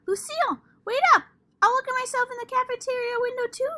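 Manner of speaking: high, loud and fast call; shouting. Acted. Sheldon shouts after Lucille as she skips away, high and loud. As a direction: shout after her eagerly. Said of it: "he shouted".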